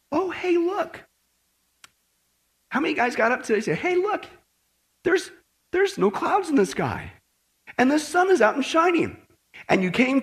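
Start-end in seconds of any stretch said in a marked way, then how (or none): 0.1-1.0 high, light pretend noticing; mock discovery. Acts someone checking the morning sky. 3.8-9.2 high-pitched, naive pretend wonder; mock discovery. Acts people noticing the clear sky.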